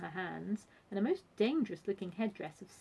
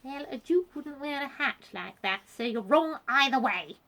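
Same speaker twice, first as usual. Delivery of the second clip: loud, cross, raised-pitch retort; snapping; 6 semitones higher, 8 dB louder than usual. A narrator's reading. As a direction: snap back loudly to end the argument. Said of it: "she snapped".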